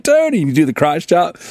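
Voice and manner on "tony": high-pitched call of a name. Retold or imitated. Teller calling Tony across the hallway, high call. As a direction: pitch it up to get his attention. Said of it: "you're like".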